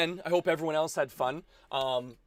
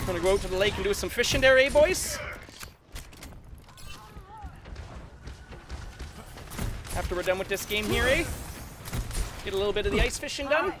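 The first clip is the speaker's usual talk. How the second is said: folksy put-on Canadian accent, pitched up; full Canadian accent; 9 semitones higher, faster than usual. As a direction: chat to the boys in broad Canadian. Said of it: "I gotta turn full Canadian here".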